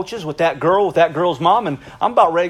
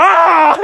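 very loud high charging "Ah!"; fierce attacking shout; battle yell; 11 semitones higher, 8 dB louder than usual. Retold or imitated. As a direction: roar as you attack. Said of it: "And I was like".

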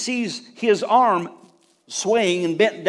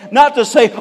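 usual speaking voice

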